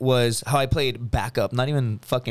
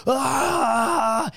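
high mock cry of pain; pained yelp; 10 semitones higher than usual. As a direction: yelp like you just got hit. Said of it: "and he's like".

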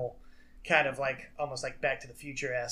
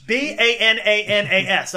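BANANAS spelled out letter by letter, high and loud; B A N A N A S punched loudly letter by letter; emphatic spelling; 6 semitones higher, 12 dB louder than usual. Real, not acted. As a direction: belt out each letter of B A N A N A S.

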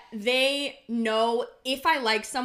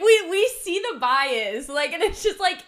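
loud, high disgusted ew; 7 semitones higher, 8 dB louder than usual. Real, not acted. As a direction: spit out the disgust, loudly. Mocking biased tea pages.

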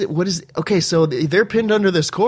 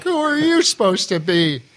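pitched-up gushing fan; high excited Foley voice; 8 semitones higher than usual. Retold or imitated. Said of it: "he's like".

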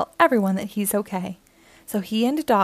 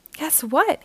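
excited shout; 7 semitones higher than usual. A narrator's reading. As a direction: announce news in a lifted, eager voice. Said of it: "he shouts as he hands Chili".

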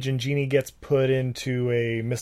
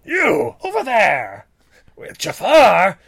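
commanding Jafar; 9 semitones higher, 3 dB louder, slower than usual. Retold or imitated. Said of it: "a scene where it was like".

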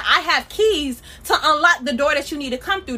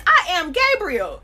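slow, drawn-out proud I AM Gabriel; 4 semitones higher, slower than usual. Retold or imitated. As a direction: lean into "I am Gabriel" slowly with swagger.

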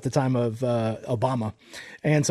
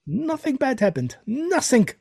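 put-on high, slow German accent; 10 semitones higher, slower than usual. Mock German denial.

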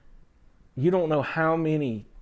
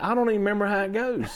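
fast flustered reply; 9 semitones higher, faster than usual. Retold or imitated. Retold panicked reply.

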